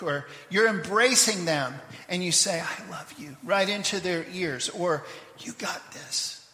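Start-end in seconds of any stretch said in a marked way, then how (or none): 2.6-3.4 breathy whisper right into the ear; tender whisper. Shown as a demonstration. Whispering love into someone's ear while embracing them. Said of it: "when you whisper in somebody's ear". 5.4-6.4 slow, close encouraging whisper; intimate whisper. Shown as a demonstration. Whispering encouragement into a friend's ear, slow and close. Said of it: "that's the kind of whisper this is".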